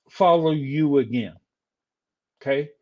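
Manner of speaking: ordinary delivery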